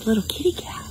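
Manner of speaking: soft, whispery hush; don't wake the sleeping kitty; hushed, slowing storytime voice; playful hush. Pretend.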